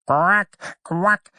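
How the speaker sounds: high quacking with a rise; mother duck quacking. A narrator's reading. Mother duck greeting her hatchlings, high quacks. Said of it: "said the mother".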